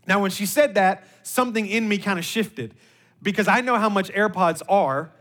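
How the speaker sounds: normal talking voice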